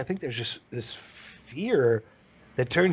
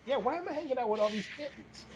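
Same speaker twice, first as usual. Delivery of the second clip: soft, higher, laughing bear wondering aloud; a bear catching on, amused; mock bear realizing; 9 semitones higher, 10 dB quieter than usual. Bear realizing it hangs out with kittens, soft and amused. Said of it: "And the bear's like".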